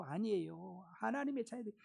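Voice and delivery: 낮고 작은 소리로 되뇌듯; "아니에요"를 힘 빼고 낮게; 나직한 부정. Real, not acted. 같은 말을 낮춰 되풀이. (나직이 되풀이하는 부정)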